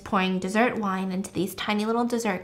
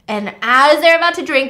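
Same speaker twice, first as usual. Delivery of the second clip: ordinary talk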